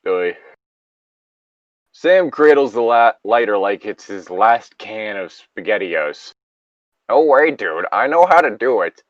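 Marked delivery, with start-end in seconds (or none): none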